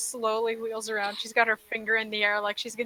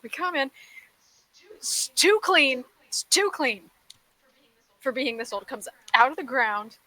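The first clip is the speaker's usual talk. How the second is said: higher-pitched, halting exasperation; exasperated Plume; 4 semitones higher than usual. Acted. Plume recaps the dungeon to the party, exasperated. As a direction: recap it in pained, incredulous bursts. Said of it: "gets exasperated".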